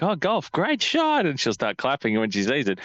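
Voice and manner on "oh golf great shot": put-on toddler voice. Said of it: "She's like".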